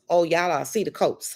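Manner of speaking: ordinary talk, nothing marked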